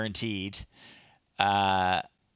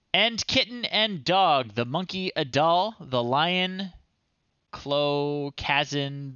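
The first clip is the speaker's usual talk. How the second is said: put-on high, slow storybook voice, pitched up for children; reading a child's story aloud; 5 semitones higher, slower than usual. Streamer reads a child's old story aloud in a high storybook voice. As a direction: read it like a bedtime tale for little kids.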